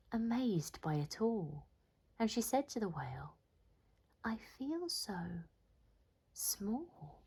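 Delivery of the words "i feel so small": soft, slow, hushed wonder; awed whisper. Acted. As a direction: whisper it, overwhelmed by the big world. Snail awed by the vast world.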